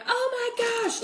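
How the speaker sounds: high mock yelp of pain; retold scream. Retelling the marinade spraying her eye, high mock yelp. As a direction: squeal it like the marinade just hit your eye. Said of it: "and I'm just screaming".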